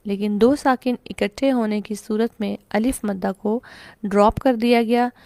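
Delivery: ordinary talk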